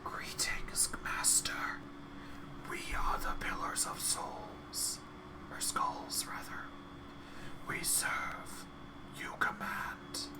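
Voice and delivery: soft whispering chorus of skulls. Acted. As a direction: breathe every word as a whisper. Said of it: "their whispers blending together in a chorus".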